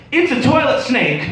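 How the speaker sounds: put-on high, loud child's cry; kid shouting. Kid pointing at the toilet, high alarmed shout. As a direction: announce it like a terrified little boy. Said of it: "he goes".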